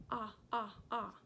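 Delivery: Ah, ah, ah said as three short, clear "ah" sounds; repeating ah sound. Shown as a demonstration. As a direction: drill the "ah" sound three times. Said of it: "the vowel O makes that ah sound".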